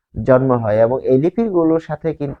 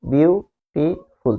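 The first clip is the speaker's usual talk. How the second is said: "Beautiful" broken by pauses into বিউ, টি, ফুল; very slow "beautiful", each syllable standing alone; split into syllables; much slower than usual. Shown as a demonstration. Teacher counting three syllables for learners, "beautiful" split by clear pauses. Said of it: "তিনটা syllable".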